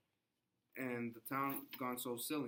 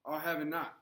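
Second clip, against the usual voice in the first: angry; 4 semitones higher, 5 dB louder than usual. Acted. Proctor angry at wife.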